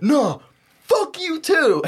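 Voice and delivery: high, drawn-out angry retort; defiant mock yell. Brother storming out of the car, defiant.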